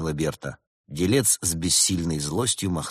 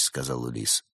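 ordinary delivery, nothing marked

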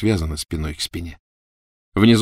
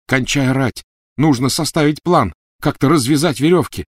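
urgent snapping; 7 semitones higher, 4 dB louder than usual. Acted. Harry snaps at Ron.

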